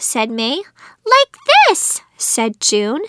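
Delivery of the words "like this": put-on high-pitched excited child's voice; eager little girl. A narrator's reading. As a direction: pipe up high as a keen young niece. Niece shows off in story.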